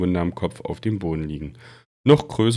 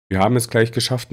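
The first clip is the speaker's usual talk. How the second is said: ordinary delivery, nothing marked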